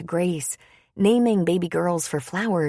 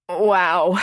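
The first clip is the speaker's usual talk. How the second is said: louder "Wow" pushed out through a groan; 3 semitones higher, 6 dB louder than usual. A narrator's reading. Pregnant woman groaning through the word as pain hits. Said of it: "she said through her groan".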